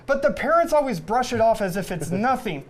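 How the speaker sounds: ordinary delivery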